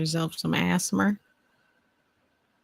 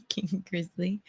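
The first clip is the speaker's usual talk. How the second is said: normal talking voice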